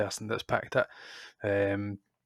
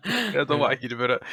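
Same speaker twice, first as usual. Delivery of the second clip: laughing; 5 semitones higher than usual. Real, not acted. Joking brush-off while laughing.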